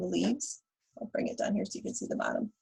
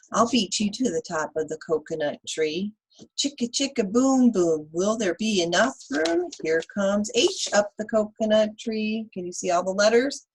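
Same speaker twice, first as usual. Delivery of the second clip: put-on lively read-aloud for kids; reading to children; 3 semitones higher, 5 dB louder than usual. Teacher reading Chicka Chicka Boom Boom to children, lively. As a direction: read the rhyme to the class with bounce.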